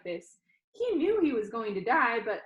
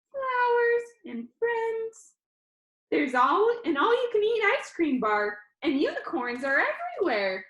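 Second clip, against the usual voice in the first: put-on upbeat young guy, higher pitched; perky showman pitching to his audience; cheerful Danny; 12 semitones higher, 6 dB louder than usual. Danny on video teasing the funeral crowd, bright and upbeat.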